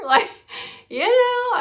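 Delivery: high and loud, disbelieving; can you believe this; reacting in disbelief to a mother refusing her son; incredulous. Real, not acted.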